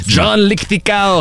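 high, slow put-on holler; drawn-out, hammed-up name call; mock stern call. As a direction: summon a child by a silly name. Mock parent calling the child.

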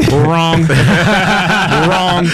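laughing it off; chuckling through the word. Real, not acted. Laughing correction of himself.

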